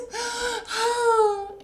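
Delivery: high loud sighing gasp; a sad bear's anguished breath; distressed gasp. Acted. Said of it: "and he moans".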